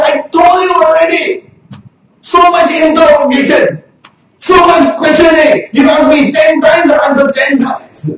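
high, loud fed-up protest; exasperated outburst. Retold or imitated. Healed man to the Pharisees, exasperated high loud protest. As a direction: vent your frustration at being grilled.

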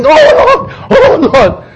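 high, loud mock wail; hammed-up pleading cry; Moses crying out. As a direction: wail it up to heaven, overdone. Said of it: "Moses is crying".

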